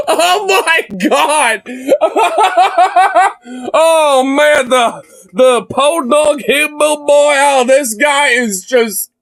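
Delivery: high, drawn-out words through laughter; real hysterical laughter. Real, not acted. Streamer losing it over the Podunk hillbilly, laughing hard. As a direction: talk while helplessly cracking up. Cracking up at the interview.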